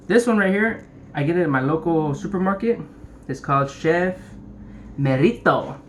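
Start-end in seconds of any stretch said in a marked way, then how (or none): 5.0-5.8 "Merito" said emphatically as a Spanish brand name; Spanish-style name pronunciation. Shown as a demonstration. Host showing viewers how to say the brand, emphatic Spanish-style "Merito". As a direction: punch out "Merito" in Spanish style, falling at the end. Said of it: "You gotta say it like that".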